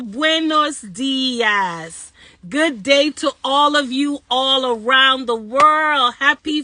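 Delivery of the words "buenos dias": buenos dias stretched out, slow; slow drawn-out greeting. Acted. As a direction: savor each syllable of the greeting. Multilingual greeting to viewers.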